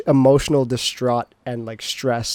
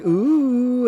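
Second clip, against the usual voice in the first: high mock teasing ooh from friends; sing-song ooh at the new couple; 11 semitones higher than usual. Friends teasing the couple at the dance, high mocking ooh. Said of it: "they're like".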